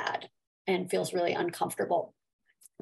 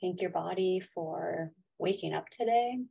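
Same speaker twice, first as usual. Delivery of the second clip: guided meditation; 5 dB quieter than usual. Acted. Leads a gratitude meditation.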